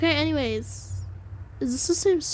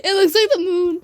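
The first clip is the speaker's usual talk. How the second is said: high, loud, through laughter; laughing; 5 semitones higher, 6 dB louder than usual. Real, not acted. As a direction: say it while cracking up. Laughing at the sight.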